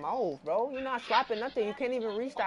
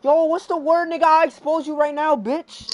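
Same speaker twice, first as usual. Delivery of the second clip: loud, high trash-talk yell; loud yelling at his teammate over the mic; 8 semitones higher, 10 dB louder than usual. Real, not acted. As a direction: shout him down.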